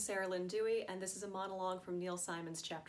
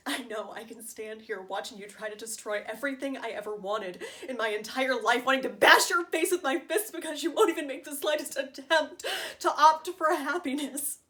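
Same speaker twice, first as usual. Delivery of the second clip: building anger; 3 semitones higher, 10 dB louder than usual. Acted. Wife confronting George for refusing happiness, building anger. Acted monologue, furious at George.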